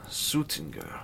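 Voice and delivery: ordinary delivery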